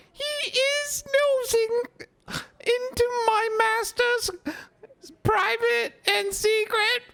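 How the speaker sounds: very high, slow, sobbing elf squeak; a tipsy elf clinging to her loyalty; tearful drunk Winky. Acted. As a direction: drunk house-elf ranting at Harry, tearful.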